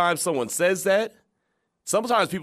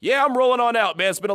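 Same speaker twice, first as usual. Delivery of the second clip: excited coach; 9 semitones higher, 5 dB louder than usual. Retold or imitated. Voicing coach, loud excited.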